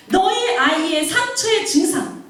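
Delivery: high, emphatic reprimand; God's thundering rebuke. Acted. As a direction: rebuke a parent as God would, pressing each phrase. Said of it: "막 호통하는 게 느껴져".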